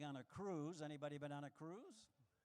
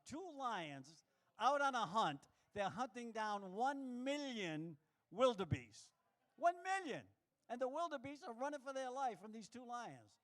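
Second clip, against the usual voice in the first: excited; 5 semitones higher, 4 dB louder than usual. Real, not acted. Building excitement about the hunt.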